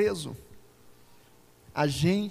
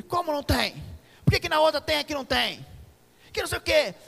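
higher, louder indignant complaint; irate customer; 10 semitones higher, 7 dB louder than usual. Retold or imitated. Man at checkout protesting to cashier, indignant. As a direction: play the angry man in line. Angry customer voiced in story.

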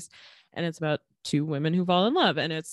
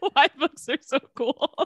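laughing; 7 semitones higher, 5 dB louder than usual. Real, not acted. Reading notes, laughing.

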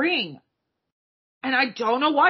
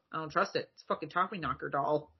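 much lower and quieter, wary; low suspicious mutter; 9 semitones lower, 10 dB quieter than usual. Retold or imitated. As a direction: grumble it distrustfully. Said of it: "And he goes".

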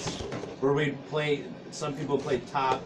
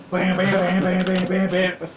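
gleeful bam shots; 6 semitones higher, 6 dB louder, slower than usual. Retold or imitated. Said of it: "you guys wanna have Halo LAN?".

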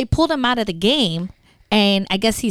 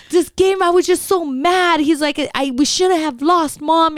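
higher, upset child voice, mildly mock sobbed; imitating crying son; 5 semitones higher than usual. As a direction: play the heartbroken boy after the loss, lightly. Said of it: "he's bawling".

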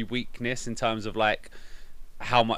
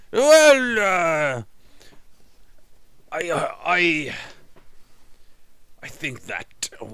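higher, slow put-on Welsh accent; 7 semitones higher, 4 dB louder, much slower than usual. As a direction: drawl out a mock Welsh accent as Hatch. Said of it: "I'm doing a Welsh accent almost".

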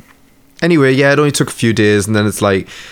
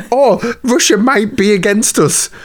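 mocking coworker; 9 semitones higher, slower than usual.

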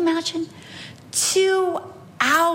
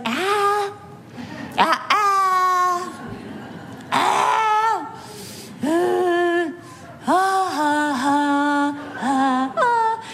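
high ah cries slowly winding down, still mock; a toddler running out of steam, lightly voiced; fading tantrum; 7 semitones higher, much slower than usual. Mother imitating her daughter's tantrum fading out. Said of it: "pretty soon she's going".

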